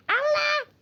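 sudden high startled shriek; high frightened shriek; startled yelp. Real, not acted. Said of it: "Sen bağırınca böyle miydin?".

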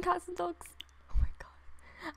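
soft whisper to herself. Real, not acted. Fan giving her sim a makeover, whispering. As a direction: whisper it, absorbed.

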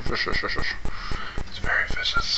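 whispering. Real, not acted. Baby talk to puppy.

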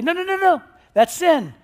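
a high, sharp no no no, then a firm verdict; scolding Pharisee. Retold or imitated. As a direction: scold someone as a rule-keeper. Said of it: "they'd say".

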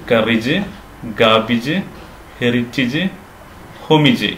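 കറിജ്, ഗാബിജ്, ഹെറിറ്റേജ്, ഹൊമിജ് all ending in short "-ij"; correct pronunciations. Shown as a demonstration. Teacher recapping, short "-ij" on കറിജ്, ഗാബിജ്, ഹെറിറ്റേജ്, ഹൊമിജ്. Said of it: "ഇങ്ങനെയാണ് ഉച്ചരിക്കേണ്ടത്".

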